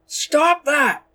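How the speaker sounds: high, loud whiny yell; frustrated whiny shout. A narrator's reading. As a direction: whine it out in frustration. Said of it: "in a frustrated, deep, but whiny voice".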